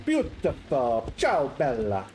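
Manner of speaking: put-on slow, dreamy Italian accent. Patrizia recalling Ulysses to the visitor, slow Italian accent. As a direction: linger on each word like a fond memory.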